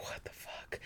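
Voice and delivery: a breathless, voiceless what the fuck; whispered disbelief. Retold or imitated. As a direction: mouth it under your breath in shock. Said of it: "I'm just like".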